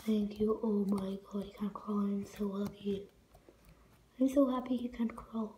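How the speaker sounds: hushed relieved whisper. Real, not acted. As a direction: whisper so he won't hear.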